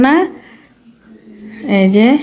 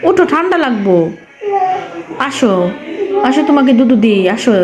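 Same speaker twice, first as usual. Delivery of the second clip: coaxing call; 4 semitones higher than usual. Real, not acted. Mother calling toddler up from floor.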